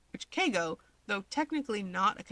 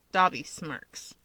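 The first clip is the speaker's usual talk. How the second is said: ordinary delivery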